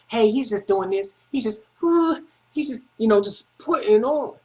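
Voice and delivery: high mocking whine, slowing at the end; mocking whiny imitation. Mocking the roommate who called the cops, in a high whiny voice. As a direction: squeak it out like a tattletale.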